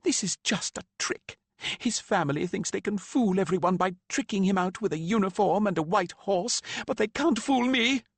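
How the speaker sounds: high, rising furious rant; grieving mother insisting it's a trick. A narrator's reading. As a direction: spit out the accusation. Mother ranting at Lan.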